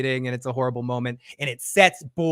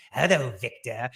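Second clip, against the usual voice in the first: lightly voiced creepy puppet, lower; 4 semitones lower, 3 dB quieter than usual. Host imitating what the actor might say, creepy low puppet voice. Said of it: "it was really creepy".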